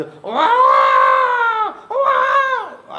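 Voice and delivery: long, high, loud drawn-out cry of wah; joyful cry. Acted. Devotees cheering as the elephant pulls, long loud cry.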